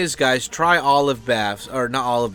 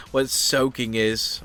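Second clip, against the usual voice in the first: ordinary talk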